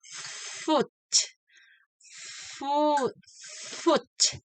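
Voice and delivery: Foot with the F drawn out and breathy; long F in foot. Shown as a demonstration. Teacher repeats the drill, F drawn out. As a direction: hold the F of Foot. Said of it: "do it again".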